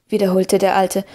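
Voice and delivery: ordinary delivery, nothing marked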